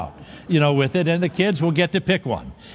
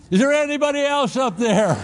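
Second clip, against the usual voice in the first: doubtful climber, high; 9 semitones higher than usual. Retold or imitated. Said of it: "He's quiet for thirty seconds".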